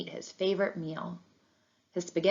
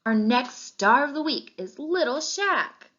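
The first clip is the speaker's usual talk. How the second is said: higher, slow, beaming reveal; excited announcement; 7 semitones higher, 3 dB louder, slower than usual. A narrator's reading. Teacher reveals the new star of the week to the class, beaming. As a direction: announce the winner with a big smile. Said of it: "His teacher had a big smile".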